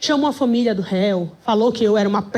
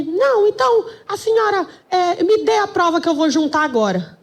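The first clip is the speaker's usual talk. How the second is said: high, flustered mock yell; judge yelling; 6 semitones higher than usual. Judge to lawyer packing up to leave, mock yelling. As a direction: imitate a judge shouting as he backtracks. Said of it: "E ele gritando".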